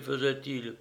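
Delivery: ordinary delivery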